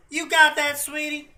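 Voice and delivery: put-on very high country Valley Girl lilt; horrible country Valley Girl. Showing how Southern girls all go, country Valley Girl. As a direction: pitch it way up, badly faked country Valley Girl. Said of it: "that was a horrible country Valley Girl".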